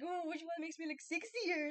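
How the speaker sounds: high-pitched whiny goofball villain; Valentino. Retold or imitated. Vlogger mimicking Valentino showing off his guns, goofy. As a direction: put on a high, silly preening voice. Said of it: "he's whiny".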